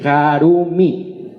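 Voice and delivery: ordinary talk, nothing marked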